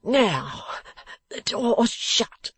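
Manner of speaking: slow, pained, halting voice; in anguish. A narrator's reading. Beaten man to the dressmaker, anguished. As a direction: push it out painfully, word by word. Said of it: "sitting up in anguish".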